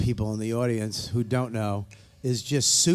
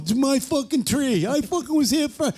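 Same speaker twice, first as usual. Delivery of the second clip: high, loud mock yell; mock angry Yankee fan; 12 semitones higher, 3 dB louder than usual. Mocking a Yankee fan at the tree farm, high angry yell. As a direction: ham up a belligerent fan fighting over a tree. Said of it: "douchebag Yankee fan going".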